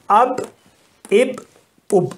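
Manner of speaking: اب said three times, short u then a closed ب with no vowel; repeated pronunciation drill. Shown as a demonstration. Teacher repeating the word for students to copy, careful drill. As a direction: drill اب over and over like a reading teacher. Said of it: "اس کو پڑھا جائے گا اب".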